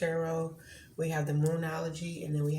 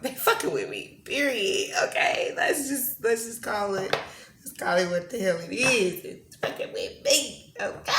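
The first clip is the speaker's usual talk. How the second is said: louder, exasperated venting, half laughing; frustrated outburst; 3 semitones higher, 5 dB louder than usual. Real, not acted. Vlogger venting to viewers about spirit messing with her, exasperated and louder. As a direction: call it out in real frustration. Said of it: "I just still feel kinda, like, frustrated".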